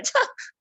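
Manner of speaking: short high burst of laughter. Real, not acted. Speaker amused by the photo mix-up, laughing. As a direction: crack up at your own story.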